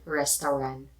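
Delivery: Restaurant ending without a T; no final T. Shown as a demonstration. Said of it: "not pronouncing the T in the end".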